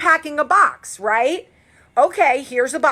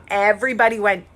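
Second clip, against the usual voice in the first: normal talking voice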